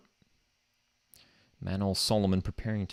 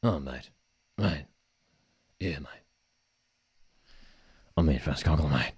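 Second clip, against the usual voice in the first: Ange impression; 4 semitones lower than usual. Impersonating Ange in press.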